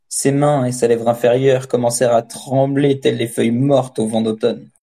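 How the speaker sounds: usual speaking voice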